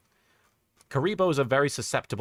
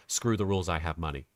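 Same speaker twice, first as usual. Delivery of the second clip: low, deliberate dramatic line reading; a mock-serious redub read, voice dropping low; specifically intentioned serious redub; 8 semitones lower than usual. Streamer tries the line as a serious redub, low and deliberate. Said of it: "deliver a very, uh, specifically intentioned".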